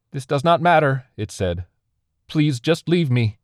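high, loud entity voice. A narrator's reading. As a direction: plead loudly to be left alone.

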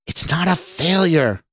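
high, indignant protest; angry exclamation. A narrator's reading. Lab man defends his program, angrily exclaimed. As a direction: angrily deny it, pitch jumping up. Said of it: "exclaimed angrily".

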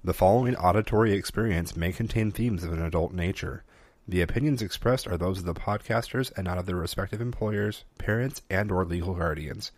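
soft, measured warning read; disclaimer read. Acted. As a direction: read the legal warning evenly. Reads the show disclaimer.

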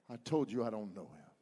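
sudden drop to low, soft voice; subdued denial. Acted. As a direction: let the outburst fall away into a low denial. Peter's denial after the outburst.

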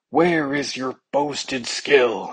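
a slow, stately king's demand; slow commanding king. Acted. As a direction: drawl it out like an ailing king. Sick king scolds physicians.

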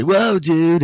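high laid-back surfer drawl; a hint of the stoner dude. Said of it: "Michelangelo still has that".

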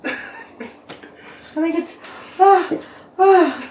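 high, squeaky, halting voice. Acted. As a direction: squeak it out, breaking into laughs. Card read in high voice.

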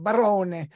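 Barone with a long o and a sounded final e; Italian pronunciation. Shown as a demonstration. Host repeats guest's surname Barone, Italian pronunciation. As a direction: say Barone the Italian way, in three syllables. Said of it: "That's the Italian way to say it".

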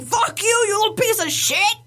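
high-pitched mock gamer rage; imitated yelling. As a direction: act out yelling insults over a headset. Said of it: "when I'm yelling on the other end".

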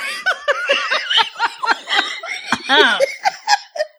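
helpless shrieking laughter; long high laugh. Real, not acted. Author overcome by her own fanfic, long shrieking laugh. Breaks down laughing while reading.

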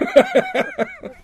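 squealing laughter; high cackling laugh. Real, not acted. Laughing at his own impression, high cackle. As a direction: crack up high.

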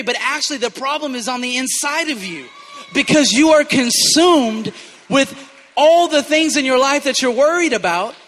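loud, high, driving pulpit voice; full-force preaching. Real, not acted. Preacher paraphrasing Jesus to Martha, loud and intense. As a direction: preach hard, pressing the point.

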